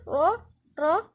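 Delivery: slow, loud را with a thick r; emphatic r and backed vowel on را; heavy Raa. Shown as a demonstration. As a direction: make each را full and dark. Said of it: "الراء هنا مفخمة".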